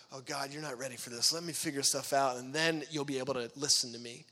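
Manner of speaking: low, patronising mock reassurance; talking to a baby. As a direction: talk down to God as if to a small child. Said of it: "treat him like a baby".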